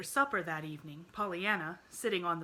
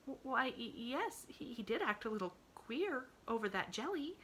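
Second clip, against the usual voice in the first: hesitant admission; 7 semitones higher than usual. Acted. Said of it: "admitted Pollyanna with a thoughtful frown".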